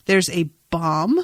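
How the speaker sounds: ordinary delivery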